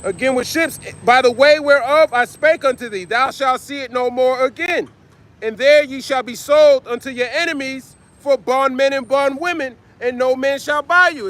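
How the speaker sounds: solemn preaching read of the verse; scripture reading. Acted. Reads scripture aloud, preaching.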